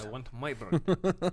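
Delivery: put-on slow hammy Russian accent; bad Russian accent. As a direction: ham up a thick Russian accent. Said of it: "really bad Russian accents".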